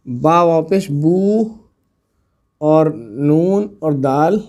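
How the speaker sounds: letters spelled slowly one by one; slow spelling out. Shown as a demonstration. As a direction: dictate each letter slowly to students. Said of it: "हम लिखें". (धीरे धीरे अक्षर बताते हुए)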